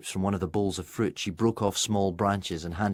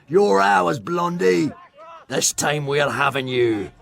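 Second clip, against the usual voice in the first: loud, high jeering yell at the player; taunting shout; 7 semitones higher, 8 dB louder, slower than usual. A narrator's reading. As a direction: bellow a gloating threat. Opponents jeering during a chase.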